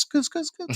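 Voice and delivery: squeaky scoot. Retold or imitated. Imitating scooting out.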